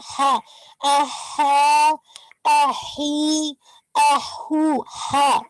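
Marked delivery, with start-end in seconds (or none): 0.8-4.8 "अहा" very slow, breathy ha drawn from the throat; throaty ha drill. Shown as a demonstration. Teacher drills the throat letter ha for the student. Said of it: "हा निकालिएगा".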